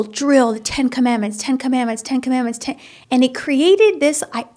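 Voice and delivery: usual speaking voice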